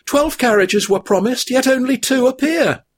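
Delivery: high weary hobbit voice; a high-pitched hobbit worn out by his burden; weary Frodo. A narrator's reading. Frodo's held figure voice.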